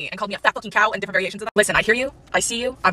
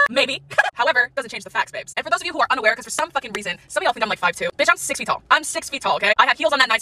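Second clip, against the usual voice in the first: ordinary delivery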